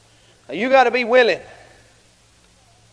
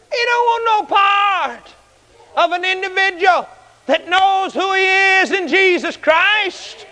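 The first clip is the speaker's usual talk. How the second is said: high, drawn-out, emphatic preaching; full-force preaching; 8 semitones higher, slower than usual. Real, not acted. Preacher telling the church the devil avoids them, full-force preaching. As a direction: preach it high with stretched words.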